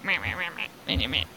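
mocking low "meh-meh" whine; whiny baby. Co-host mocking the rude man as a whiny baby. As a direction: imitate a whiny little baby grumbling. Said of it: "Like, whiny little baby".

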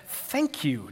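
mock-sincere, backhanded thanks; soft sarcastic thank-you with a falling tune; Jimmy Fallon. Writing a backhanded thank you card, mock-sincere. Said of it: "Jimmy Fallon style".

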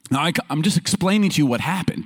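normal talking voice

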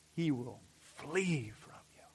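hushed whisper; whispered promise to the congregation. Real, not acted. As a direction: drop to a whisper for effect. Sermon point in hushed tone.

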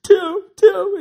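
high, tearful retold cry; imitating a crying call. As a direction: cry out the name like a man overcome. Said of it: "he was crying".

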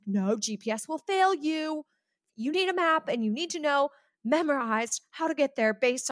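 high-pitched mock mom lecturing; impersonated mom. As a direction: imitate a mom warning her teenager. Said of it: "impersonating someone".

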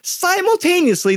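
"Simultaneously" pitched very high, falling at the end; comic high emphasis on "Simultaneously"; repeated story word. Shown as a demonstration. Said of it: "I use that word ten more times in the story".

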